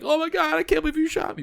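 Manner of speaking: very high, whiny imitation of shock; mock wailing victim. Imitating the guy who got shot, mock wailing. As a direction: squeal it in hammed-up disbelief. Acts out the shot man's whining.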